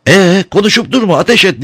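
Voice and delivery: high-pitched impatient yell; shouting. A narrator's reading. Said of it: "diye bağırırken".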